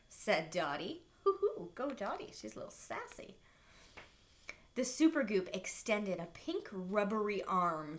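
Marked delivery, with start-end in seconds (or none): none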